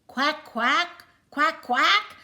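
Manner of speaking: loud, high duck quacks; loud quacking. Acted. Ducklings quacking loudly at the speeding cars. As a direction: quack like a row of panicked ducklings. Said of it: "went Jack".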